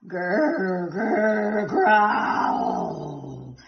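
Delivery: growing growl. A narrator's reading. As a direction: growl stronger each time. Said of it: "growling got louder and louder".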